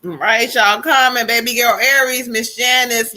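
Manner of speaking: normal talking voice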